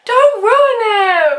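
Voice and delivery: loud high yell, slow; shout. Real, not acted. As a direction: yell to stop her. Game fight over marshmallows.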